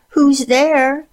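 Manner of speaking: put-on high frail old woman; quavering old granny; frail grandmother voice. A narrator's reading. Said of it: "asked Grandmother in a frail voice".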